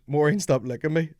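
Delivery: mock shout at a dog; retold roar. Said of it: "and he roars".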